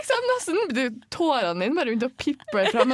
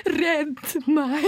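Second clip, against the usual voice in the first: mouthed plea; 6 semitones higher, 4 dB louder than usual. Retold or imitated.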